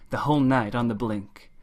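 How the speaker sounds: usual speaking voice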